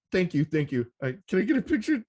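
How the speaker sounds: high, rushed gushing thanks; starstruck fan. Retold or imitated. Fan meeting [name] at the meet and greet, high rushed gushing. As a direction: gush at your idol, tripping over the words.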